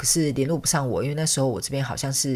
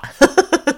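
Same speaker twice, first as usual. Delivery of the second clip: a bright burst of laughter, louder and higher; short laugh; 14 semitones higher, 8 dB louder than usual. Real, not acted. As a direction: laugh at your own joke. Laughs at her own story. (自己笑出声)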